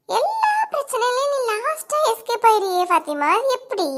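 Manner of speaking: slow, high sneering jab; slow mocking taunt at Fathima. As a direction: draw it out to needle her.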